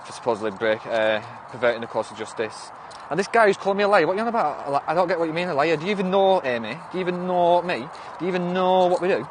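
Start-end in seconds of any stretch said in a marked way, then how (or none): 3.1-9.3 higher-pitched, affronted questioning; indignant challenge. Real, not acted. Streamer challenges a commenter calling her a liar, indignant. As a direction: fire indignant questions at a doubter.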